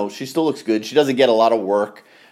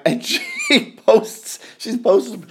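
laughing; 12 semitones higher, much slower than usual. Real, not acted. Laughing about actress on OnlyFans.

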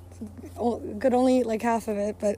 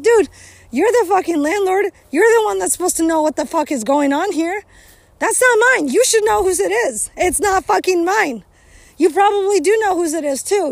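retold yelling at landlord; 5 semitones higher than usual. Telling off the landlord about old furniture, heated and raised. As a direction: tell off the landlord hotly, pitch up. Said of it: "I was like".

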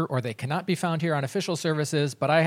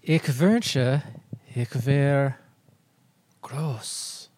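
trying German; much slower than usual. Shown as a demonstration. Host testing the movie Big in German, halting German delivery. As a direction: attempt careful German, pausing between phrases. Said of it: "let me try it".

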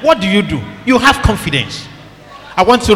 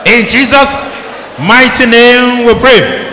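preaching prayer. Acted. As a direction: proclaim each line with fire.

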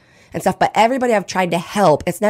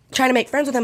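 normal talking voice

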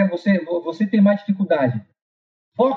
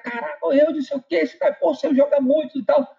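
impressed classmates; 7 semitones higher than usual. Retold or imitated. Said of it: "os caras".

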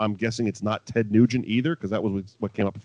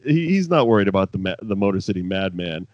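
normal talking voice

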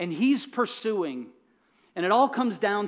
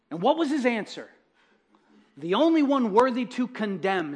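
higher, louder building sermon; rising preaching; 5 semitones higher, 4 dB louder than usual. Acted. Preacher pressing the gospel story on the congregation, higher and louder.

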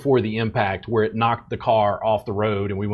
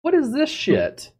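high indignant disbelief, much higher; exasperated rant. Retold or imitated. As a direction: squeal in disgust at the whole thing. Ranting about therapists' hands.

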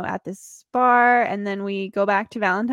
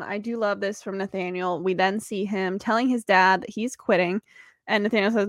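ordinary delivery

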